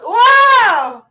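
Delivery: high, loud, drawn-out wow; big show of wonder at the reveal; amazed shout. Pretend. Reveal of the Hall of Sounds.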